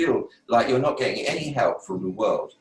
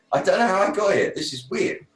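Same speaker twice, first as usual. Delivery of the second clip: higher, puzzled wonder, then hushed; bewildered; 9 semitones higher than usual. Retold or imitated. Retelling his arrival at the desk, mildly amazed. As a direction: marvel at being there.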